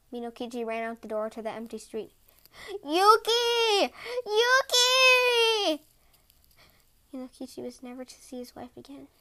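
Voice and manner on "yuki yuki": anguished calling. A narrator's reading. Husband calls into empty street.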